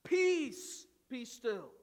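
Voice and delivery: loud, commanding rebuke; declaring. Acted. Jesus commanding the storms of life, loud declaration. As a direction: declare it with authority over the storm. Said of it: "he's declaring".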